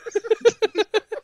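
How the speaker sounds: very high, loud cackling laugh; teasing laughter. Real, not acted. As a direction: burst into a squealing laugh. Teasing Josh about his mom.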